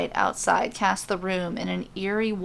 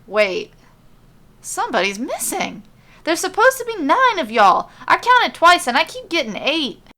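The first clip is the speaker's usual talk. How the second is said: growing alarm; 8 semitones higher, 6 dB louder than usual. Acted. Messenger panics to the group, loud and alarmed. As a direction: voice climbing high and loud in alarm.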